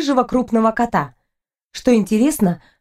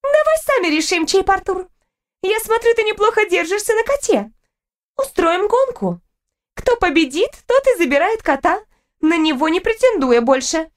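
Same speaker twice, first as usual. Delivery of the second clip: high-pitched, assertive challenge; high girlish rival laying down terms; haughty rival girl, challenging; 9 semitones higher than usual. Acted.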